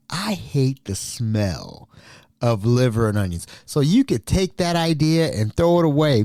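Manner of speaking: ordinary talk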